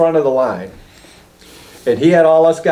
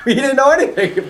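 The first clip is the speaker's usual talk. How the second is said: high shout through laughter; bursting out laughing, much higher; 10 semitones higher than usual. Real, not acted. Laughing at the poor guy.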